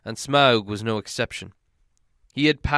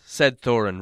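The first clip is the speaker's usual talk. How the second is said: ordinary delivery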